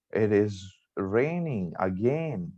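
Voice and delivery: slow, weary annoyance; annoyed. Shown as a demonstration. Plans to go out spoiled by rain, annoyed. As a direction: drag it out, not happy about it. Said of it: "I'm annoyed".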